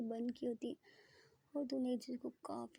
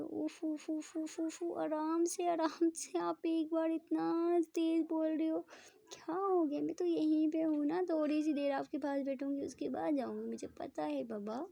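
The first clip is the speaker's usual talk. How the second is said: higher, slow calming coo; soothing a clingy girlfriend, higher and slow; 5 semitones higher, 4 dB louder than usual. Acted. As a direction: hush her gently, slowly. Ishani calms clinging Preeta.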